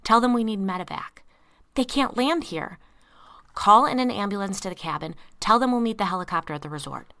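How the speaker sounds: loud urgent commands; urgent orders. A narrator's reading. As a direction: shout instructions fast. Urgent rescue orders shouted.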